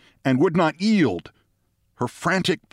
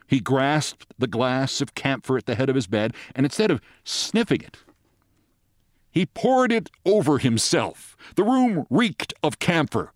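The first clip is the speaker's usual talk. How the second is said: plain reading voice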